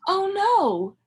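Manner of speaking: high, loud mock alarm; storybook alarm for kids; oh no dismay. A narrator's reading. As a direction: exclaim in playful dismay.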